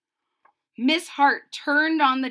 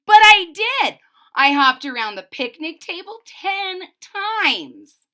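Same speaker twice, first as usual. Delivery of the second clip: much louder, higher protest; insistent shout; 6 semitones higher, 11 dB louder than usual. Acted. Embarrassed Ruby insisting to the giggling class, loud. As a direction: stand your ground and shout it.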